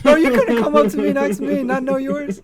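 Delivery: high, loud, incredulous outburst; exasperated protest. Retold or imitated. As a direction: squawk in mock outrage at little brother. Acted protest to little brother.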